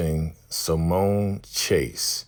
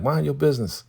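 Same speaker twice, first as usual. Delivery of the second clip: higher-pitched imitation of a woman brushing him off; mock Peaches; 9 semitones higher than usual. Voicing Peaches telling Shad to butt out, higher-pitched mock voice. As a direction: toss it off in a raised voice.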